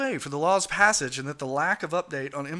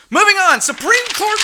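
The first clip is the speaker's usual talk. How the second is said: shouted topic switch; loud announcement; 12 semitones higher, 10 dB louder than usual. Real, not acted. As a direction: announce it loudly.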